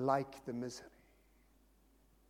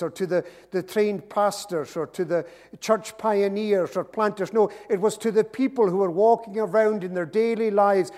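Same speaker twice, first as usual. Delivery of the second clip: higher, loud, building preacher's delivery; impassioned preaching; 5 semitones higher, 7 dB louder than usual. Real, not acted. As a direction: drive it home to the congregation, raised and insistent. Preacher builds to full force.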